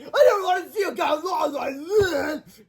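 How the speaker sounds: high, slow crying shout; sobbing wail. Acted. Melvin sobbing as he runs off. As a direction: blubber it out. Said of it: "ran out of the classroom sobbing".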